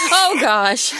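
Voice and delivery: loud startled gasp of fear; real fright. Real, not acted. Startled by the hen, loud frightened yelp. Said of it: "I'm so scared".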